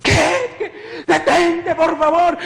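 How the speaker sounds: high-pitched frantic pleading; súplica aterrada. Retold or imitated. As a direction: beg desperately for it to stop. Pleads with attacker, retold. (súplica aguda y desesperada)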